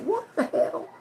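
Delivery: mock astonishment; retold surprise. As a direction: gasp it out in disbelief. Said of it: "I was like".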